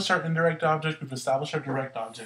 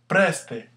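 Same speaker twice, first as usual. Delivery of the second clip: "Preste" with a loud first E; stress on first syllable; 2 semitones higher, 5 dB louder than usual. Shown as a demonstration. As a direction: punch "Pres" in "Preste", let "te" fall. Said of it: "The first E".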